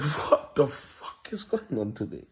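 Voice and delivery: slow, drawn-out disbelief; retold exasperation. Man retells his exasperated reply in traffic, slow and drawn out. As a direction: drag it out, fed up. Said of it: "I said".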